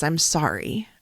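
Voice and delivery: mild quiet tearful imitation; tearful apology. Son apologizing to mom in the car, quiet and tearful. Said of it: "And he starts to cry".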